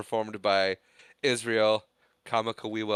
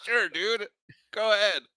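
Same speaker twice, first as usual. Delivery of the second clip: laid-back dude; 10 semitones higher than usual. Retold or imitated. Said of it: "And Henry's like".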